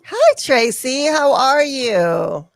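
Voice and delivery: high, slow, sweet greeting voice; sing-song greeting. Pretend. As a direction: coo it like a cartoon character. Greeting in put-on voice.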